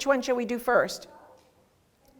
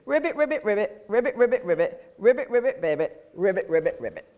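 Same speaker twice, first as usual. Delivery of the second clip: sing-song ribbit croaks; frog song; faster than usual. Pretend. A teacher singing frog croaks to the children. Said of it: "And you'll sing".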